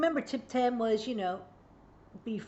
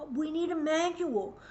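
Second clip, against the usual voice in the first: raised, pleading declaration; exasperated plea; 6 semitones higher than usual. Retold or imitated. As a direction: plead for it like you've said it for years. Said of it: "I spent so many years saying".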